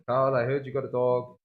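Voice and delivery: put-on lightly done thick Dutch accent of an old grandfather; a hint of Dutch Opa greeting his grandson. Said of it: "This thick Dutch accent".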